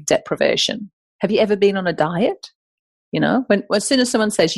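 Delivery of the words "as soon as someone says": ordinary talk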